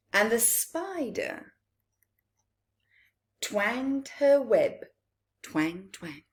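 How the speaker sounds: lilting storybook read-aloud; gentle bedtime-story telling; reading to children. A narrator's reading. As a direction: read it to a room of little ones. Reads picture book aloud.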